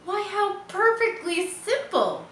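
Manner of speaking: high, bright voice for Charlotte's sudden idea; a spider thrilled with her own clever plan; delighted spider. Acted. Charlotte exclaiming her idea to herself, high and delighted. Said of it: "she said to herself".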